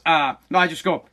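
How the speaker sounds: normal talking voice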